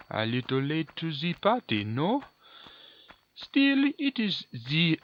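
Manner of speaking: mock French villain, cut off mid-line; terrible French accent. Gamer quoting the villain's famous line in a bad French accent. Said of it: "A terrible French accent".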